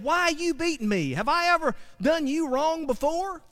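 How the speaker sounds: mild hurt donkey asking why; donkey pleading. Acted. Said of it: "The donkey says to Balaam".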